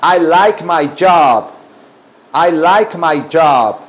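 slow, drawn-out teacher model; deliberate, word-by-word drill; slow modelling. Shown as a demonstration. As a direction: stretch each word for learners to copy. Teacher models answer for class.

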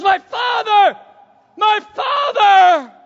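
shouted high and loud, the second drawn out; anguished cry. Acted. Elisha crying after Elijah taken to heaven, full shout. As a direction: cry out to the departing prophet. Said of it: "And he goes".

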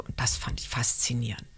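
dropped to a near whisper; a soft, awed near-whisper; hushed. Real, not acted. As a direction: breathe it out softly, awed. Recalling the hushed theatre.

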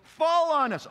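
high, loud scream of terror; a frantic high plea; desperate cry. Acted. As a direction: shriek it at the hills. People begging hills to fall.

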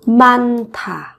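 많다 with ㅎ carried over into a breathy "tha"; aspirated sound. Shown as a demonstration. Teacher showing learners the linked consonant, 많다 slow and aspirated as "man-tha". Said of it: "phát âm thành âm thở".

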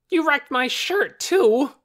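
put-on high, loud child's shout; angry shout. A narrator's reading. Torin wriggles in the doctor's grasp, angry shout. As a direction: shout the blame, hitting TOO. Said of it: "shouted Torin".

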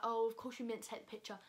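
usual speaking voice

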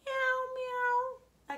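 high, sustained mewing; high kitty imitation; cat meowing. A narrator's reading. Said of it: "A cat".

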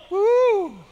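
loud, high "woo"; sudden celebratory holler; excited whoop. Real, not acted. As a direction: let out a long high whoop. Preacher whoops at the story's reveal.

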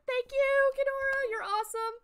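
high, sweet-toned thanks; grateful gushing. Real, not acted. Thanks a supportive viewer.

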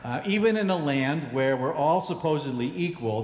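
ordinary talk

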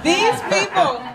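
real giggling restart; laughing restart. Real, not acted. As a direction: restart the phrase cracking up. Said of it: "oh, there's a story".

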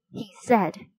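ordinary delivery, nothing marked

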